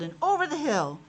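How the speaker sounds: usual speaking voice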